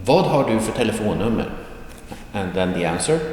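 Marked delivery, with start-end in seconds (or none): 0.1-1.5 fast, connected question; quicker natural delivery. Shown as a demonstration. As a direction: run the words together at conversational speed. Same question repeated at natural speed.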